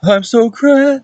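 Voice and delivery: high, drawn-out mock kid voice; squeaky, stretched beginner impression; young beginner performer. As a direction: imitate an untrained youngster, high and slow. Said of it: "whenever they're young, they're gonna be like".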